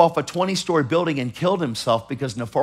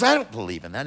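quiet shrug of disbelief; unbelieving priest; 7 semitones lower, 6 dB quieter, faster than usual. Retold or imitated. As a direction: lower and softer, offhand. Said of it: "He goes".

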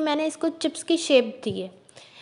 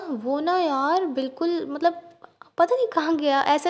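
face covered by wet sheet; talking with sheet mask on. Real, not acted. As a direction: lips moving under facial mask.

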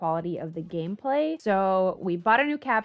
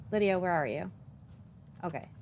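ordinary delivery

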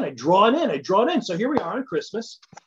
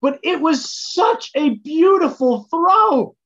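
imitating a sweet, upbeat mom, higher, louder and slow; Barb Painter; 10 semitones higher, 6 dB louder, slower than usual. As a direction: gush over it like a doting mother. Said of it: "in classic Barb Painter fashion".

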